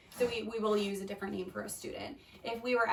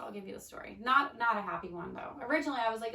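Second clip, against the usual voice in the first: normal talking voice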